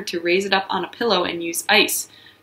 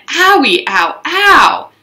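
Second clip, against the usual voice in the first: higher, louder mock ows; pained cries; 7 semitones higher, 7 dB louder, much slower than usual. Junie hurting her toe, mock ow. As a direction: yelp in cartoon pain. Pain after kicking water can.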